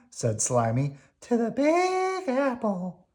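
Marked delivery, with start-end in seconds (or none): 1.2-3.1 high squeaky worm; high, cheerful little worm. Acted. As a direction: pitch it up like a tiny worm.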